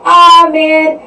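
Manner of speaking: retelling her fed-up rant at the guy; annoyed outburst. Retold or imitated. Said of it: "she was like".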